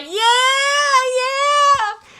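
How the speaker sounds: high, stretched-out whooping; cheering avatars. Acted. Imagining avatars emoting at a party, high mock cheering. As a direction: whoop it high like a dancing crowd. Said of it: "they're all like".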